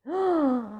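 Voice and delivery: sharp mock gasp of shock. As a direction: gasp as if scandalised. Reader gasps at nuts.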